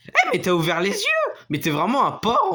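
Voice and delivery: high, loud playful indignation; mock outrage. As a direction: scold the viewer in jest.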